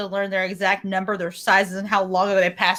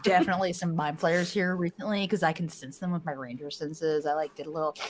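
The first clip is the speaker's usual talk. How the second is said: softer, lower ranger report; keeping quiet; 3 semitones lower, 7 dB quieter than usual. Acted. Ranger warning the party of mind flayers, keeping quiet. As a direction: keep it down while reporting on the tracks. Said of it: "I should probably be quiet now".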